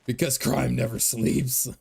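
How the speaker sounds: slow, put-on vigilante voice; Batman. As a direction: deliver it as a brooding superhero line, slowly. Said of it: "sounds like a line Batman would say".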